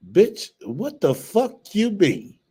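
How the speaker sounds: slow, high, drawn-out outraged question; outraged disbelief. Real, not acted. Reacting to a clip, high slow outraged disbelief. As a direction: stretch it out in shocked outrage.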